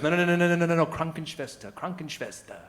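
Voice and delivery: acted-out girlfriend setting him straight; imitating hasty correction. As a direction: cut in to correct, all in a rush.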